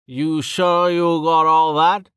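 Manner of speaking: put-on slow teasing old man. A narrator's reading. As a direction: needle him playfully. Uman teasing Rain.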